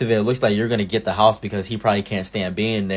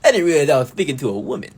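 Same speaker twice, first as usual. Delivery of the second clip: higher, laughing put-on gentleman voice; mock posh apology; 5 semitones higher, 3 dB louder than usual. Mocking his own overly formal reply to the manager's wife, posh put-on voice. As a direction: fake a flustered gentleman's apology. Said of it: "I'm like".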